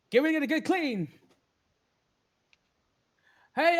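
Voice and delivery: high, bright-pitched NPC chatter; Sindri's voice. Acted. Smith Sindri chats with the broker, high voice.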